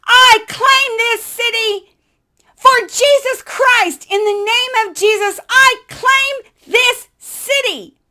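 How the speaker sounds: slow, loud, high proclamation; declaring alone in the dark tunnel, slow and loud; bold declaration. Retold or imitated. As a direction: proclaim it like a prayer of faith.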